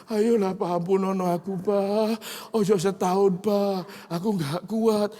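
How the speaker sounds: high whiny plea, slowing at the end; boy tugging his father's shirt, pleading; begging child. Retold or imitated. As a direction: beg for mercy like a sulky boy. Son shakes father, begs mercy.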